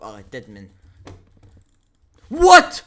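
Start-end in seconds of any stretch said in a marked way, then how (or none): 2.3-2.8 very high, loud "what?"; sharp rising cry of shock; astonished shout. Real, not acted. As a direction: shriek it in disbelief. Astonished reaction in game.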